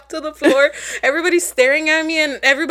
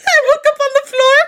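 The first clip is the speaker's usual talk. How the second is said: higher, laughing disbelief; 7 semitones higher, 4 dB louder than usual. Real, not acted. Telling a friend she fainted, laughing. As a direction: laugh while you tell it.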